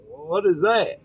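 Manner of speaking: usual speaking voice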